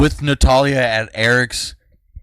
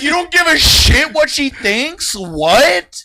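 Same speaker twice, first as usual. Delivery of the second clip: high, loud, slow incredulity; outraged mock shout; 8 dB louder, slower than usual. Girl confronting Eric over defying grandma, loud mock outrage. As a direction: imitate a kid shouting in disbelief.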